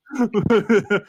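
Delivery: a loud, high laugh bursting out; high burst of laughter. Real, not acted. Laughing after teasing the bandmate on air, high burst. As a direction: crack up at the joke.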